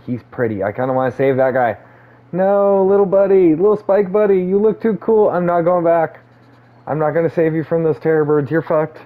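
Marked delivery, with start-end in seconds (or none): 2.3-9.0 high cooing voice to a pet. Acted. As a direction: coo at a cute little creature. Cooing at a creature in game.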